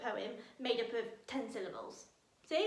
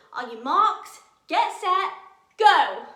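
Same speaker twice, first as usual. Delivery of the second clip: shouted starter's countdown, louder to the end; race countdown; 5 semitones higher, 14 dB louder, slower than usual. Acted.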